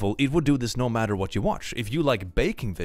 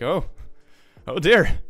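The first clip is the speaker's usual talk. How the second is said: mock dismay; 8 semitones higher, 6 dB louder, slower than usual. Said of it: "It's like".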